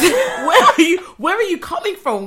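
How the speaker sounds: high, loud panicked questions through laughter; hammed-up alarm, laughing; mock scared. Waitress retelling being startled by staff, mock scared. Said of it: "I kept getting scared".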